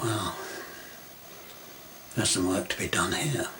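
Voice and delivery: hushed aside, whispered to himself; quiet whispered inner remark; whispered thought. Retold or imitated. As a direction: breathe it like a private thought. Inner thought on seeing friend.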